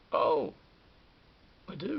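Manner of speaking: a sudden loud pleased "Oh"; surprised. Retold or imitated. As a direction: blurt it out, caught off guard. Surprised by a compliment.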